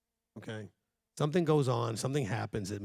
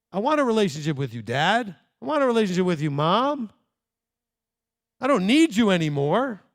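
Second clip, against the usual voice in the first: put-on grown child to parents; 7 semitones higher, 8 dB louder than usual.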